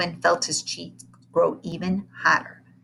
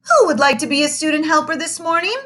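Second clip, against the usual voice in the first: bright, higher teacher voice; a cheery classroom teacher, pitched up; 9 semitones higher, 5 dB louder than usual. Acted. Teacher asks class for a helper, pitched up.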